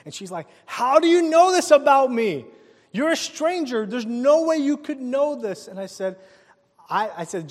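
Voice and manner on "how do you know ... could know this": high, loud astonished disbelief. Retold or imitated. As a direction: react as if a stranger read your mind. Girl stunned by the stranger.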